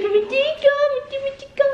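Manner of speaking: very high, squeaky voicing of the jealous dog; high mock dog voice. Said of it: "She's like".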